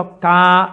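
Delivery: కా sounded slowly and loudly from the throat; throat sound demo. Shown as a demonstration. Preacher showing where కా is voiced, loud slow demo. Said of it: "కా పలకాలంటే ఎక్కడ పలకాలి".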